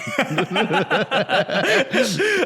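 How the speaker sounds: loud, high burst of laughter; helpless laughter. Real, not acted. Co-host failing to keep a straight face, helpless laughter. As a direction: crack up and let it run. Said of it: "No, I can't".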